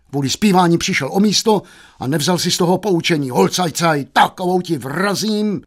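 high, angry tirade; enraged father. Acted. Father threatening his idle son, high angry tirade. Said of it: "rozkatil se".

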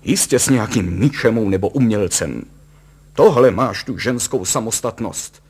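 loud, blustering angry tirade; booming indignation, climbing in pitch; thundering. A narrator's reading. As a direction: rage on and turn the blame on her. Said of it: "burácel pan Kalous".

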